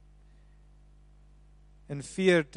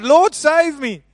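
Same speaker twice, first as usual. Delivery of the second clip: higher, louder and slow plea; mock desperate cry; 10 semitones higher, 10 dB louder, slower than usual. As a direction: cry out as if sinking. Peter crying out while sinking.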